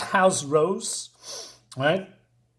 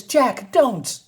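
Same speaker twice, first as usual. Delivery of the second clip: higher-pitched plea in a woman's voice; pleading woman; 6 semitones higher than usual. Acted. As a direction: beg him to stop, pitched up. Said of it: "And she says".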